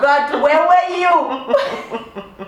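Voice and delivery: high, loud, giddy praise; excited. Real, not acted. Raving to friends about the rich guy, high giddy gushing. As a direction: gush like he's a blessing.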